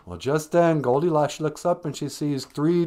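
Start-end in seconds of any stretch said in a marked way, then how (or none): none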